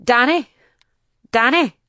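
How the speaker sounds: loud, high desperate calling; frantic shout of a name across chaos. Retold or imitated. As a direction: call out for your son in panic. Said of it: "I kept shouting".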